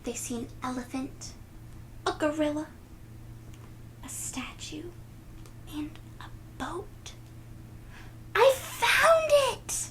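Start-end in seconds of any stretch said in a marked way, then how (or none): 4.0-7.1 soft whispered list. Real, not acted. Storyteller whispering what they see. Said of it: "whispered list". 8.4-9.9 high, loud, slow shout with a laugh; excited exclaim. Acted. Nellie finding the apple, shouting with joy. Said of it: "exclaims Nellie".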